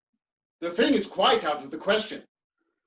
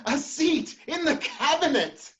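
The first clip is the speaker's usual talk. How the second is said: astonished; 9 semitones higher, slower than usual. Acted. Offered a cabinet seat.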